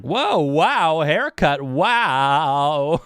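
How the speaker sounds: loud, swooping, stretched-out wows; mock amazement. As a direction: imitate an over-the-top startled greeting. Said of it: "dude, they're like".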